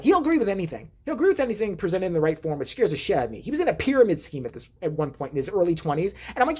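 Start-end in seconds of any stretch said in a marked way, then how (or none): none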